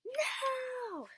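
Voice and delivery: high, loud mock wail of no; mock dismayed cry. Everyone groans at the waking bugs, mock dismay. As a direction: wail it as if the bugs are a disaster.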